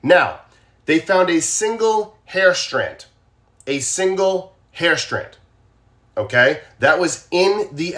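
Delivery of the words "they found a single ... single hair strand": slow emphasis. Shown as a demonstration. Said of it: "repeats for emphasis".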